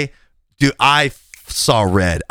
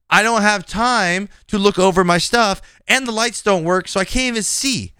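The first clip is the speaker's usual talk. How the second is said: high-pitched, pleading self-defence; retold frustrated protest, pitched up; exasperated protest; 7 semitones higher than usual. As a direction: argue back, exasperated, voice riding high. Acted argument, protesting to supervisor.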